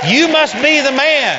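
high, loud, amazed cry; excited exclaim. Retold or imitated. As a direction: burst out in amazed recognition. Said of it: "And she said".

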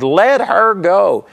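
slow, insistent quoted command; retold yell. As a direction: imitate a yelled order, drawing out each word. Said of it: "he yelled at those women".

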